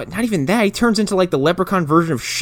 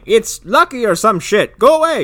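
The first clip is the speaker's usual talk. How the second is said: high-pitched, impatient mock shout; dismissive grandpa; 9 semitones higher, 5 dB louder than usual. Grandpa sending Russell off with the coin, high and dismissive. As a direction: shoo him off with a high, raised voice.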